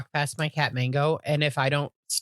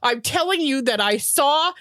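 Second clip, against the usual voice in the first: high, slow, insistent mock protest; imitating her friend; 9 semitones higher, slower than usual. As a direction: plead it up high and slowly. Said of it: "And she's like".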